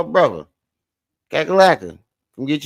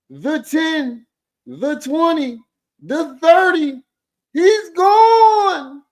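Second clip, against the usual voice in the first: much higher, slow, crying-out sportscaster shout; hammed-up play-by-play; 14 semitones higher, much slower than usual. Roasting the forehead with a frenzied touchdown call. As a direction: scream the touchdown like a frantic announcer.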